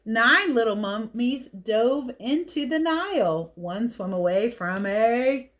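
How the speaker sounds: put-on lively read-aloud for kids; picture book reading. A narrator's reading.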